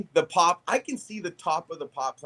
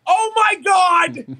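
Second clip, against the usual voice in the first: panicked; 12 dB louder, slower than usual. Retold or imitated. Panicked exclamation on hearing yell.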